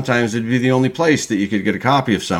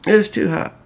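whined, pitched up; higher whiny complaint; 10 semitones higher than usual. Shown as a demonstration. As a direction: whine that it's too hot. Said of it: "It's, it's too hot to drink".

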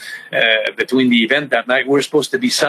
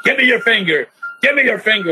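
higher, insistent officer's order; imitating the officer; 7 semitones higher, faster than usual. Wrestler retells the officer at fingerprinting, lightly voiced insistent order. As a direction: repeat the cop's demand, pushy. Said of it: "the officer kept telling".

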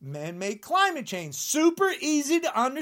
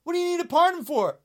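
usual speaking voice

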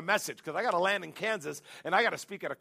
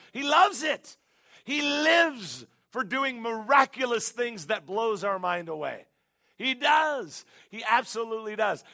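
higher and louder, fired up; excited preaching; 4 semitones higher, 4 dB louder, slower than usual. Real, not acted. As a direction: lift the room with excitement.

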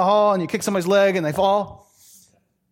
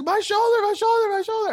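much higher whiny cry of pain; wailing kid. Retold or imitated. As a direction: whimper like a hurt boy. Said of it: "He's like".

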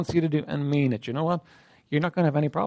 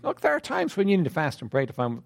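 ordinary talk, nothing marked

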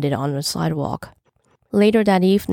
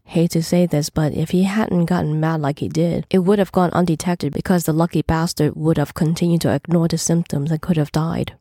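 plain reading voice

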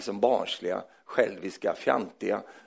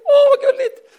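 very high, louder falsetto coo on åh; doting baby talk; 10 dB louder than usual. Acted. Preacher mocks parents gushing over a peeing child, falsetto coo. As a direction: squeal it like a besotted parent.

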